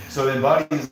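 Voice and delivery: ordinary talk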